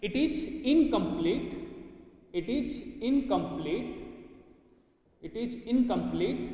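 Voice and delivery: "it is incomplete" said slowly three times, rising; slow repeated dictation. Shown as a demonstration. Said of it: "यानी कि".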